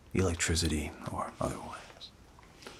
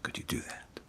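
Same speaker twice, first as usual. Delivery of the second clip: whisper; 9 dB quieter than usual. Real, not acted. Whispered instruction session.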